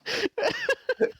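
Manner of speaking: squealing laugh, pitch shooting way up; a real laugh that cracks high; helpless giggle; high burst of laughter. Real, not acted. Laughing at inbred joke.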